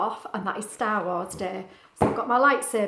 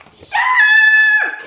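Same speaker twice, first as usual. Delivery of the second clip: shout; 15 dB louder than usual. Acted. Shark reveal to children.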